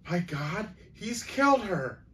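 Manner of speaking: high-pitched gasp of horror, rising sharply; horrified gasp. A narrator's reading. Grandmother believes she overheard a killing, horrified gasp. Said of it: "Graham gasped".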